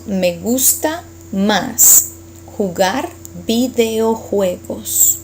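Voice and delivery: very slow, word by word, x0.5; slow model sentence. Shown as a demonstration. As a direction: say it slowly for learners.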